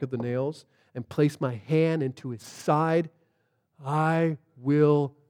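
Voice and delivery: low, soft, deliberate conditions; skeptical. Retold or imitated. Thomas answers the disciples' news, skeptical and measured. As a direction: set out each demand with pauses. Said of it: "skeptic in him".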